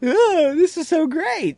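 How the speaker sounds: high, loud, delighted cry; overjoyed cow. Pretend. Cows freed to pasture, a high, loud joyful cry. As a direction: squeal with pure joy at the grass. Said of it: "they were just like".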